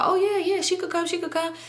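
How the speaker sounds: high-pitched imitation of an eager yes; eager agreement. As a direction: mimic jumping at the offer. Cousin eagerly agrees to offer.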